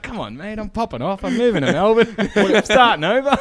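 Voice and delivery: high, loud, laughing quitter's voice; laughing mock defiance. Retelling his boss why he quit, laughing high and loud. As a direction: crack up while declaring you're leaving.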